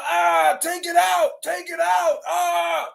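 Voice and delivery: high-pitched wailing plea; mock pained cry. Mimicking another inmate heard down the aisle, high mock wailing. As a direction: cry out like someone begging for it to stop. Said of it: "He talking about".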